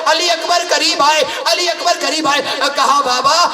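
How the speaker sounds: high, impassioned lament recitation, near shouting; preaching at full force. Acted. Preacher to mourners at a majlis, impassioned wailing lament. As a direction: pour grief into the telling of the tale.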